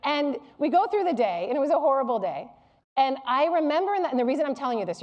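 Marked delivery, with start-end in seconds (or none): none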